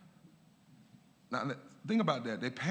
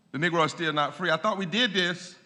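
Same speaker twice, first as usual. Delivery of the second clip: loud, raised-pitch sarcastic protest; sarcastic mock complaint; 6 semitones higher, 8 dB louder than usual. Lecturer voicing Doctor King's point about promised freedom, sarcastic loud protest. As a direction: exclaim it with mock incredulity, high and loud. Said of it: "He was really being sarcastic".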